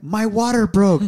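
high, loud flustered blurt; nervous. Retold or imitated. Nervous husband telling the nurse, flustered. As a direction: blurt it out in reenacted nerves. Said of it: "I was so nervous".

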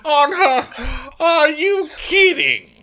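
very high, loud mock shriek; outraged disbelief. Councillors hear a woman may inherit, outraged. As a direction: shriek it as if scandalised.

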